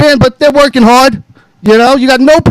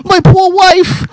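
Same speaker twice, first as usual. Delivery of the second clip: put-on high, slow babyish whine of my poor wife; mock crybaby whine; 9 semitones higher, slower than usual. Host mocking the wrestler's sympathy plea with a slow, high crybaby whine. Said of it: "try to fuck".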